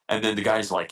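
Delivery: ordinary delivery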